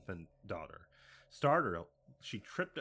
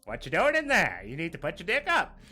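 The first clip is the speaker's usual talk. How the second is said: loud high scolding shout; gruff scolding yell; 7 semitones higher, 10 dB louder than usual. Pretend. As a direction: bark at the teens in the woods. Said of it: "he just goes and".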